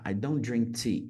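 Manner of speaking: ordinary delivery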